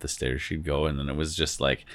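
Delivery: ordinary talk, nothing marked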